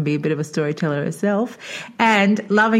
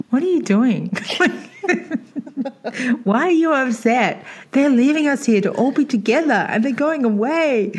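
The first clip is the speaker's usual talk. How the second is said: put-on higher, amused disbelief at crying kids, laughing through it; mock bewildered; 7 semitones higher than usual. Child at boarding school, baffled by classmates crying, amused. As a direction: play the puzzled kid who can't see why anyone cries.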